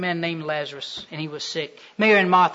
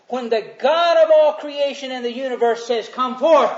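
raised, driving, repetitive sermon cadence; fervent preaching; 6 semitones higher, 4 dB louder than usual. Real, not acted. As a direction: hammer each repeated phrase home. Preaches at force.

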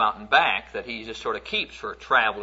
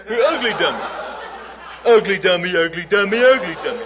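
put-on taunting little boy; 8 semitones higher, 7 dB louder than usual. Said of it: "explain to her how ugly she was".

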